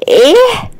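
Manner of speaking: high, rising mock-offended "what?"; استنكار. A narrator's reading. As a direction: shoot the pitch up in surprise. Wife reacts to teasing. (استنكار بصوت عالي)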